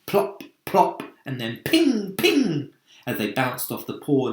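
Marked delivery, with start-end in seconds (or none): 0.1-1.0 plop, plop popped out high and breathy; clicky imitation of hail; sharp unvoiced plop sounds. A narrator's reading. Narrator sounding out hailstones hitting the peach, sharp and unvoiced. 1.6-2.7 ping, ping pinged high and bright; high ringing ping. A narrator's reading. Narrator sounding hail bouncing off the ladybird's shell, high pinging.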